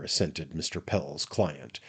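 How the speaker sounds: ordinary delivery, nothing marked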